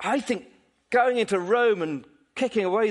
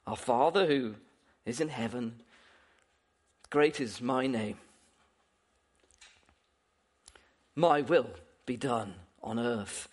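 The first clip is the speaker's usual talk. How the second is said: mock self-centred prayer; 9 semitones lower, 4 dB quieter than usual. Shown as a demonstration. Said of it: "we're changing the prayer somewhat".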